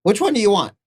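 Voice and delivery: high-pitched merchant creature voice; little merchant character. Acted. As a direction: squeak it out as a small trader.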